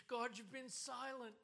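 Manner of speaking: high, loud, aching plea to God; desperate cry of a hurting believer; anguished pleading prayer. Acted. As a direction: pour it out like a broken man praying. Voicing David's anguished prayer.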